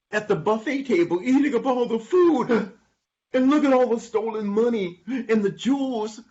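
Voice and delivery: high, eager reporting voice; excited donkey. Acted. Donkey reports robbers' loot to friends, high excited voice. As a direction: tell it up high with wonder.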